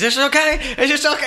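high, loud comic screech; mock screaming. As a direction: imitate a man screaming the question. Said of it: "he just started screaming".

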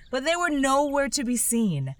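ordinary delivery

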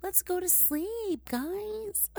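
high sleepy whine. Real, not acted. Urging viewers to sleep, high drawn-out whine. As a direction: coax them to bed in a whiny plea.

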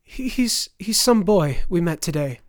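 high, loud, stammered excuse; stammering. A narrator's reading. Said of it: "The mother stammered".

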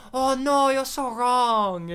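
a petulant villain taking offence; mock offended. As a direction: ham up a childish huff. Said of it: "And he's so offended".

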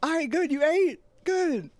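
high, easy check-in; light caring check-in; casual check-in voice. Retold or imitated. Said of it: "Just be like".

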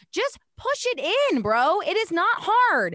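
high, slow, stretched-out scolding; drawn-out mock lecture; exasperated. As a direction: lean hard on every word, fed up. Venting at husband about the door.

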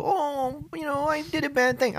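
high, soft mock-contrite voice; put-on sheepish whine; mock apology. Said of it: "I'm not gonna come on here and go".

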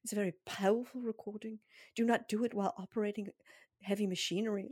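put-on accented recording disclaimer, measured and solemn; possibly South African accented announcement; hypnotist's foreign accent. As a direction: mimic the accented voice on a hypnosis tape. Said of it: "I don't know what that accent is".

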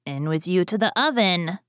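ordinary delivery

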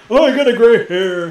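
high, whiny mock complaint. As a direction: whine about the gray hair. Mocking friend's complaint.

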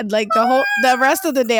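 usual speaking voice

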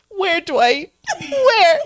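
high, loud plea through laughter; squealed imitation of desperate questioning; laughing mock demand. Acting Oscar's demand, cracking up.

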